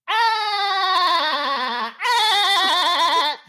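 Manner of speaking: very high, sustained rattling "ah" laugh; SpongeBob SquarePants laugh. Shown as a demonstration. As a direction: rattle the throat on long, piercing notes. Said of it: "impersonation of SpongeBob SquarePants laughing".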